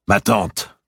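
loud, high address; Robert to his aunt. A narrator's reading. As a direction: call her out.

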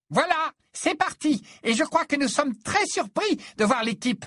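high, loud commentator voice; energetic play-by-play, pitched well up; match commentator. A narrator's reading. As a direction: announce the kick-off to a whole stadium.

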